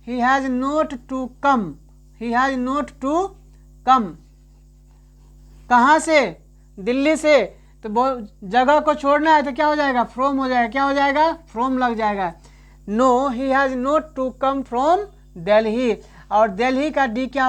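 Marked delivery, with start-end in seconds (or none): 0.1-4.1 slow dictation. Shown as a demonstration. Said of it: "slow teacher dictation". 12.9-16.0 slow dictation. Shown as a demonstration. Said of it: "slow teacher dictation".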